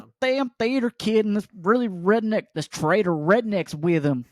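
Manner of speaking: put-on griping husband in a Southern accent. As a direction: call out to the wife, then rant in Southern drawl. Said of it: "I hope he's at home with his wife".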